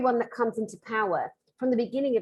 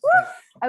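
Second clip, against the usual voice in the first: excited whoop; 9 dB louder than usual. Real, not acted. Fanning herself in the heat.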